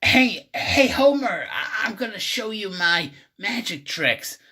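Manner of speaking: a hint of an eager cartoon son; Bart Simpson. Bart calls Homer to see his tricks, lightly put-on high voice. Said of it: "Bart's all like".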